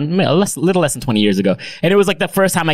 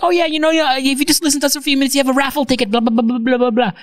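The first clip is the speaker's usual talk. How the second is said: much higher, fast sales patter; mock salesperson; 11 semitones higher, faster than usual. Sales staff rushing through the raffle spiel, fast mock patter. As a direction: rattle off the pitch, hammed up.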